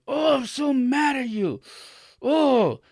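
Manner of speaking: imitating an upset child's high, loud, drawn-out whine; mock-furious kid wailing far up in pitch; upset kid outburst. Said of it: "very upset, and said".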